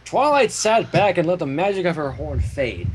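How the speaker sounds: plain reading voice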